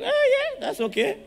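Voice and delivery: high, laughing mock of a careless parent. Preacher mimicking a parent who shrugs off silence, laughing high. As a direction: squeal it through a laugh, brushing it off. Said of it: "And they're like".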